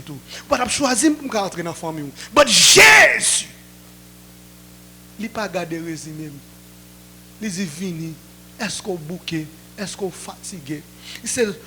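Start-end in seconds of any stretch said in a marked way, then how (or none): 2.3-3.5 long drawn-out shout of the name; shouted proclamation. Real, not acted. Preacher shouting Jesus' name to the congregation, drawn-out shout. 7.7-10.8 softer, lower, gentle calling; tender invitation. Retold or imitated. Jesus gently inviting a weary son, soft and lower.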